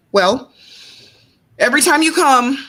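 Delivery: ordinary delivery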